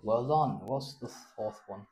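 ordinary talk, nothing marked

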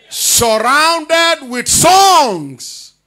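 very slow, stretched proclamation; emphatic preaching. Real, not acted. Preacher repeats the verse to the congregation, emphatic preaching. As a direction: draw out each phrase of the verse, starting loud.

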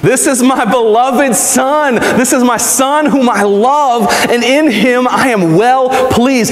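高めの声でゆっくり、笑みを含んだ神の声; 神の宣言をゆっくり、少し高めに; voice of God. Acted. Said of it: "What did the voice of God say?".

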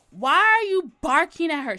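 loud accusing question; confronting. Retold or imitated. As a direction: challenge her sharply, loud. Girl confronts her.

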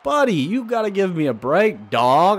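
high-pitched, exasperated pleading; worked-up gamer protest; exasperated complaint. Real, not acted. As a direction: plead for a break, pitched well up. Pleading with umpire while losing.

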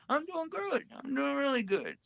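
high put-on voice, a bit soft; imitating the woman claiming she's fine; mimicking the woman. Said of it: "asking Charlie".

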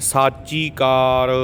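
ਸਾਚੀ ਕਾਰ with long aa in both words; measured model of ਸਾਚੀ ਕਾਰ; careful long vowels. Shown as a demonstration. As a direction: say ਸਾਚੀ as saa-chee, unhurried. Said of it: "ਸੋ ਧਿਆਨ ਨਾਲ".

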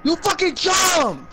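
high, drawn-out outraged shout; exasperated high holler; angry yell. Real, not acted. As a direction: shout the accusation, stretched out. Yelling at teammate's mistake.

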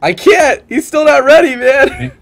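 very high, loud exasperated outburst; exasperated protest. Real, not acted. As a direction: protest loudly, pitch shooting way up. Protests that player is not ready.

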